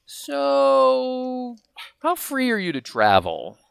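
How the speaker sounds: high, drawn-out mock D C voice; imitating D C. Friend on the phone, mimicking D C sounding him out. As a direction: pitch way up and stretch it slowly like D C. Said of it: "calls me up and goes".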